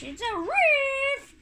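very high, loud drawn-out cry; high-pitched excited shriek; excited squeal. Real, not acted. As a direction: squeal it out, stretching the end. Exclaims at a game discovery.

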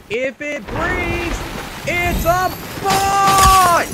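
high, slow, loud shouted slogan; slow rising war cry, loud on the last word; hammed-up battle cry. Game-shouting catchphrase.

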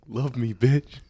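high-pitched teasing demand; playful imitation of being too direct; mock blunt demand. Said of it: "pretty direct when I don't".

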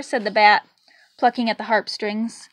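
plain reading voice

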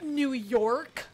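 drawn-out New York with an over-hard R; imitated American accent. Mimicking an actress's bad American accent, hard-R accent. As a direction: overdo the American R, slow and loud. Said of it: "you can tell she's doing an accent".

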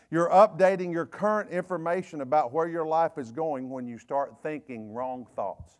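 ordinary talk

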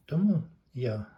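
ordinary talk, nothing marked

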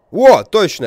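loud high sudden realization; surprised exclamation. Real, not acted. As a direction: blurt it out. (внезапное озарение)

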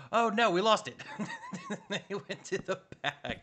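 loud, high gasp breaking into laughter; real laughing alarm, high and loud; laughing dismay. Real, not acted. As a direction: cry "oh no" and crack up. Loses the item, laughing dismay.

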